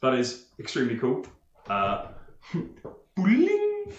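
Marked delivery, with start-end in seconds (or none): 3.2-3.9 high-pitched "bling" sound effect; imitated magic chime. Game master voicing the illusion appearing, high chime. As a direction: make a high little magic ping. Player voices the spell effect.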